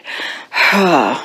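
long breathy exhale; weary sigh. A narrator's reading. Teacher giving up on Splat's questions, long sigh. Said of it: "Missus Wimpy Dimple sighed".

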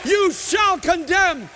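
high-pitched, impassioned proclamation; fervent preaching. Acted. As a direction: preach it at full force, building to a shouted praise. Preaching climax with praise.

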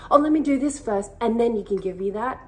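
high, loud, rushed eagerness to give back first; a people pleaser jumping in to return the favor; eager people pleaser. Retold or imitated. Imitating her old self rushing to repay a gift, high and loud. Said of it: "sitting back and being like".

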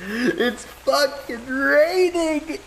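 high, drawn-out mock lament; mock tragic wail. Mourning a lost pet dodo, hammed-up high wail. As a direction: ham up the tragedy of the rain.

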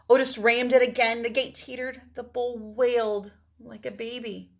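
usual speaking voice

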